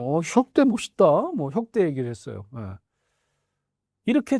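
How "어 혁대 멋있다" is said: mock praise. Praising the belt.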